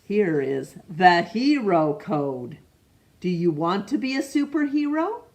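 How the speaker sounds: ordinary delivery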